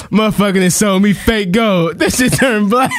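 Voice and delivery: high voice, cracking up on the words; laughing while reading. Real, not acted. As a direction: push the post out through laughter. Reading funny post while laughing.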